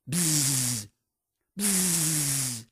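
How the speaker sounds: soft high buzzing; bee buzz. Acted. Bee buzzing round the woodcutter, soft buzz.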